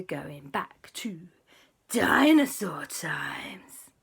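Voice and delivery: very slow, stretched whisper; mysterious whisper. Acted. Auntie invites Superman on a secret trip, whispering. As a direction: reveal a wondrous secret under your breath.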